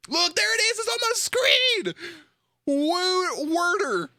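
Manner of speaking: loud, very high squawk; high-pitched, worked-up exclaiming; incredulous outburst. Real, not acted. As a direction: cry out in comic disbelief. Outraged at seeing the word on screen.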